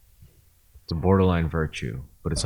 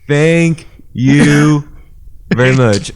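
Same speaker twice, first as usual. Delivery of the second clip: higher, louder put-on voice; higher, louder mock thank-you; mock showy voice; 8 semitones higher, 11 dB louder, much slower than usual. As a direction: ham up a thank-you like a show host. Teasing mock voice.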